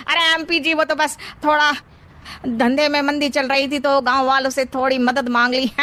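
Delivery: ordinary talk, nothing marked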